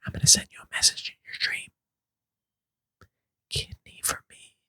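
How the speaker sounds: eerie fast whisper. Acted. Doctor sending a message into the sister's dream, in an eerie whisper. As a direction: breathe it into her ear.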